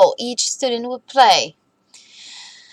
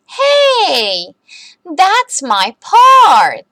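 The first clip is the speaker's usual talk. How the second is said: put-on higher, louder, slow child protest; indignant protest; 7 semitones higher, 6 dB louder, much slower than usual. Sophie scolding Max for taking her part, indignant. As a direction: claim it back, outraged and drawn out.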